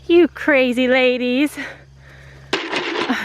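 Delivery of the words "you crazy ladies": slow, drawn-out teasing to the goats; affectionate scolding; fond teasing. Real, not acted. Talking fondly to goats.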